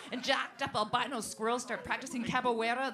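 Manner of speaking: usual speaking voice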